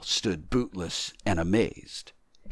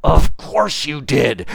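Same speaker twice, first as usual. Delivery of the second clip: loud, slow, higher insistence; emphatic disembodied voice; insistent unseen voice; 8 semitones higher, 8 dB louder, slower than usual. Acted. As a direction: press the point slowly and firmly. Voice insists to doubting tramp.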